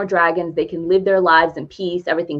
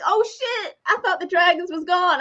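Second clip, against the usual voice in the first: higher, softer, imitating shocked villagers; mock shock; 10 semitones higher, 4 dB quieter than usual. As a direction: play the villagers' fake surprise. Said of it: "everybody's acting all shocked".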